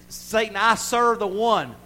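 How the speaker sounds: high, loud, driving sermon shout; full-force preaching. Real, not acted. Preacher telling the church God has their back, full-force preaching.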